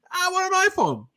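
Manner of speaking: squeaky stubborn customer parody; mock stubborn customer. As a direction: play a stubborn buyer who wants only an iPhone. Acted stubborn iPhone customer.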